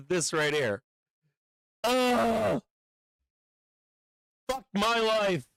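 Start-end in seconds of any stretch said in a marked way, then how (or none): 1.8-5.5 high, drawn-out groan into a curse; real frustrated despair; exasperated. Real, not acted. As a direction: groan and lament your rotten luck. Groans over the missed trade.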